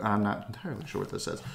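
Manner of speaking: plain reading voice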